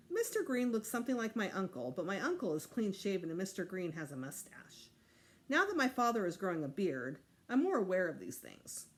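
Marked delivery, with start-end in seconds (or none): none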